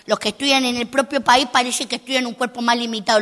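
ordinary talk

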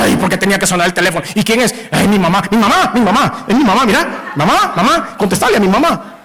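rising, flustered pleading; a nervous wife rushing to prove it's her mother; hammed-up anxious insistence; mock panicked wife. Frightened wife answers phone.

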